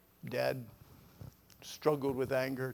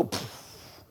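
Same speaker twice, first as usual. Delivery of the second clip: breathy, voiceless "poof"; imitated whoosh; 5 dB quieter than usual. Patient imitating blood rushing through, a breathy puff. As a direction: let it go as a puff of air. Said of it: "I felt the blood go".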